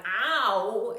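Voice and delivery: a sudden loud yelp of hurt; sharp cry of pain. Retold or imitated. Woman thrown half out of bed cries out Ow. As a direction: blurt out Ow as if slammed into the bed. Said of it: "and I'm going".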